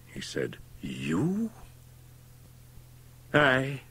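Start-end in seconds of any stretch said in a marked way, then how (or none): 0.8-1.5 hushed, softer, rising question; startled whisper. A narrator's reading. Doctor shocked to meet Fettes, startled whisper. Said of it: "in a startled whisper". 3.3-3.8 high, drawn-out retort; defiant confirmation. A narrator's reading. Fettes answering the startled doctor, defiant.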